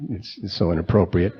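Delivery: ordinary talk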